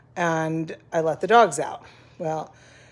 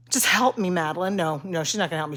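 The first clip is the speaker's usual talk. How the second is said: ordinary talk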